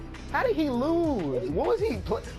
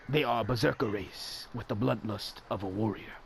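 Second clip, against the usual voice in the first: documentary narrator; 9 semitones lower, 6 dB quieter than usual. Acted.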